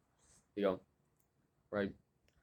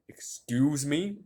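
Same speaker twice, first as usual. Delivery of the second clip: slow, louder, drawn-out indignation; indignant excuse me; 5 semitones higher, 6 dB louder, much slower than usual. Pretend. Pretend comeback to the teacher, slow and indignant. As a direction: stretch it out, daring her. Imagined confrontation.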